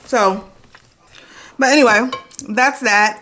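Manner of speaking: talking while chewing breakfast; words around a bite of food; mouth full. Real, not acted. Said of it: "stop chewing my mouth full".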